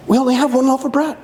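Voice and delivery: high, quick fretting disciple; worried disciple. Acted. Disciple fretting to others in the boat, high and quick.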